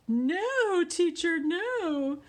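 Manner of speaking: put-on high, loud alarmed no from kids; loud child refusal. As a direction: cry out no like a scared child. Kids' horrified refusal retold.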